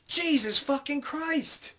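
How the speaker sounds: high, slow, drawn-out disbelief; appalled exclamation. Real, not acted. Vlogger recalling pee on store floor, appalled exclamation.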